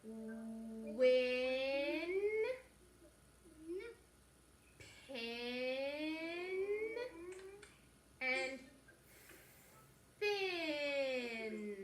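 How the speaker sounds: drawn-out, stretched words; slow reading. Shown as a demonstration. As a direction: read each word slowly, holding it. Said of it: "read them with me".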